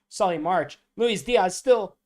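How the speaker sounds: high, loud play-by-play build; excited commentary. Acted. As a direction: call the action rising with the play. Commentating the match.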